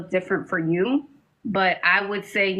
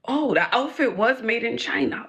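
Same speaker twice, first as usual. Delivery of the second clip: ordinary talk, nothing marked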